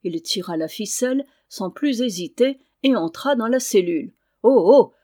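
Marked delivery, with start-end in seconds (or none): 4.4-4.9 loud, brusque cry cutting in; abrupt exclamation, breaking in; exclaimed. A narrator's reading. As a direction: interrupt with a much louder 'oh, oh!'. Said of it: "s'écria frère Bruno en interrompant brusquement".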